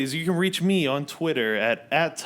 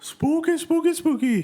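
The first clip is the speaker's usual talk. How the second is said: high spooky sing-song; spooky Halloween singsong; 5 dB louder than usual. Pretend. Kicking off Halloween season.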